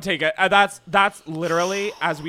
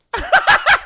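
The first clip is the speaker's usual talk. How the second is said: squealing laugh; 9 dB louder than usual. Real, not acted. Laughing at tequila smell.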